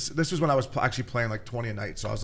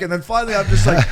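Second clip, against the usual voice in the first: ordinary delivery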